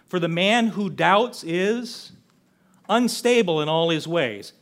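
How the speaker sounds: slow, weighty reading of each phrase; slow emphatic scripture. Acted. Preacher quoting James slowly to his congregation, weighty and deliberate. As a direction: lay every word down slowly.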